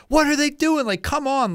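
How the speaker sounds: fed-up fan shouting at the game; yelling at the radio. Retold or imitated. Fan yelling at his radio over a losing team, exasperated high yell. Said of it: "I'm yelling at my radio".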